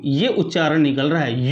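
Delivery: usual speaking voice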